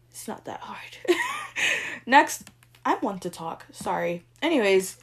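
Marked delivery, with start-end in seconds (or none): 0.1-1.0 "It's not that hard" whispered, much softer. Real, not acted. 1.0-2.0 whispered giggle. Real, not acted. Laughing after muttered complaint.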